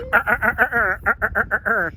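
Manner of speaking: loud, rapid staccato gun rattle; machine gun noises. Shown as a demonstration. Mum showing the war plane noises she made as a kid, loud rattling. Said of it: "those are the type of noises I make".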